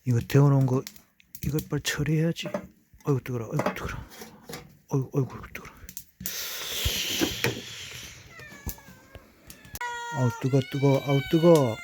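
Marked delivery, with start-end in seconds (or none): 3.0-11.8 talking through hot food; words round a hot mouthful; mouth full of hot food. Real, not acted. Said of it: "뜨겁다고". (뜨거운 음식 문 채로)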